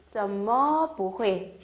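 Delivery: 怎么不会 drawn out slowly; slow model reading. Shown as a demonstration. Teacher modelling the question, slow and clear. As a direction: lay out each tone slowly. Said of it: "왜 못하지?".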